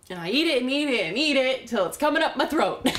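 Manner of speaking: worked-up binge confession; frantic confession. Acted. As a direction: pile up each repetition with rising urgency.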